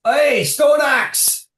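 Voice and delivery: slow, high, loud shout across the workshop; shouted call. Acted. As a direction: bellow his name down below. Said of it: "he is going to say".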